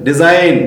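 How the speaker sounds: "డిజైన్" ending cleanly on N; silent G. Shown as a demonstration. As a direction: say "డిజైన్" with no G before the N. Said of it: "ఇక్కడ జీ పలకలేదు".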